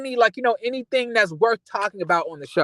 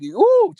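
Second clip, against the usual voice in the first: high, loud exasperated ooh; a shocked squeal of disbelief; sharp ooh; 10 semitones higher, 5 dB louder than usual. Real, not acted. Vlogger's exclamation mid rant.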